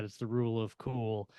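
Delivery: normal talking voice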